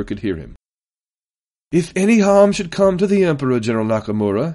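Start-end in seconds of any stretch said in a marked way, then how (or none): 1.7-4.5 high, loud bark at the start; raised public threat; barked warning. A narrator's reading. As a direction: bark it for the whole chamber to hear. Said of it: "he barked".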